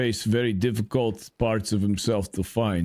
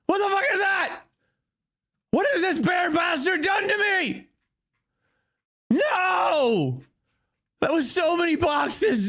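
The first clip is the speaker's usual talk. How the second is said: high, furious yelling; shrill mock tantrum; hammed-up rage. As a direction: scream at the bear who wrecked the stash. Character rages over lost loot.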